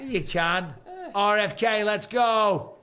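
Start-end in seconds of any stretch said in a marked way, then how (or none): 1.1-2.7 loud hype for a trading card; hyped-up cheer. Acted. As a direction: cheer it like a fan. Hyping cards like a crowd.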